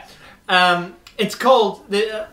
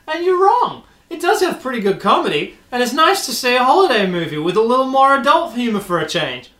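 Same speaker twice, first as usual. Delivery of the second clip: earnest reviewer getting higher as they go; indignant review writer; 5 semitones higher than usual. Acted. Podcaster reading a fan's movie review, in an indignant character voice. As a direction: plead the movie's case in a put-on fan character. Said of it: "the character that I think the person who wrote this".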